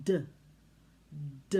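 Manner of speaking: D tapped with the tongue behind the teeth; slow exaggerated speech modeling. Shown as a demonstration. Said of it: "behind your teeth and say D".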